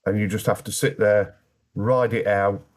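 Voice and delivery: ordinary talk, nothing marked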